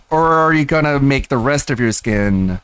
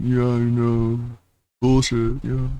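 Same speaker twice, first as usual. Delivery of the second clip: yes man voice; 3 dB quieter, slower than usual. Retold or imitated. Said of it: "He's such a fucking yes man".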